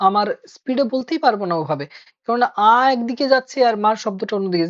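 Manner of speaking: usual speaking voice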